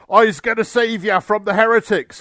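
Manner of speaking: high, loud orc bellow. A narrator's reading. Orc boss charging to save the Ultramarines, bellowing. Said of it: "he bellowed as he charged".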